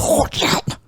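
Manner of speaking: mock German accent, guttural; hammy German pronunciation. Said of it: "that was my German accent".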